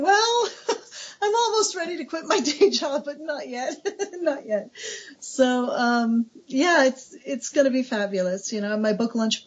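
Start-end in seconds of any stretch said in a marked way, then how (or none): 0.0-4.7 high-pitched, laughing through the words. Retold or imitated. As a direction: giggle through each phrase as you say it. Author laughing at desk about book.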